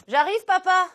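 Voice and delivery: high, loud call from another room. Pretend. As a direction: shout back to dad like a kid. Acting as a child calling her father.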